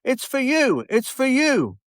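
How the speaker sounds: high, teasing it's for you; lightly voiced playful star. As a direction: tease him gently as the crowd roars. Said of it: "as if to say".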